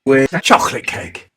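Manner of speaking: ordinary talk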